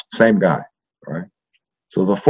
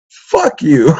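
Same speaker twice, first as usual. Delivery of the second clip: a higher, punchier fuck you at the father; defiant curse; 7 semitones higher, 4 dB louder than usual. Retold or imitated. Kid cursing out the priest while kneeling, defiant. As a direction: spit it out at the priest. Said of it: "And I said".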